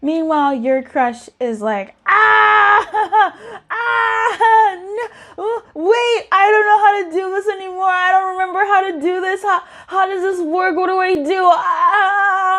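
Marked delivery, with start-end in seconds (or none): 2.1-11.5 high, loud mock-panicked wail; hammed-up meltdown, pitched way up; freaking out. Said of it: "also freaking out". 11.5-12.6 long, high mock wail; hammed-up sustained "ah" of alarm; freak-out scream. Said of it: "also freaking out".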